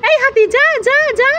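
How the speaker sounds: put-on high little boy shooing an elephant; child shooing. Little boy shooing the toy elephant, in a high child voice. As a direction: shoo it away like a small child.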